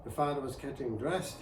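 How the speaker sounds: ordinary delivery, nothing marked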